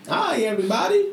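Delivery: high, put-on cartoon greeting; Simpsons greeting impression. Podcaster recalling their old Simpsons opening, high cartoon voice. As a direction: sing out a high-pitched cartoon hello. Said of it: "we used to open with The Simpsons".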